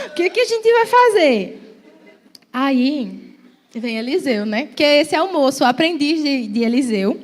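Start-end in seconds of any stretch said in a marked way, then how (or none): 0.1-1.8 frightened servant. Acted.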